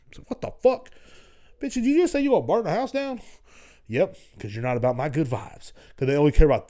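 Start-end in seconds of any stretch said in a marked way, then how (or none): 0.3-3.2 outraged retort. Retold or imitated. Acted outraged reply.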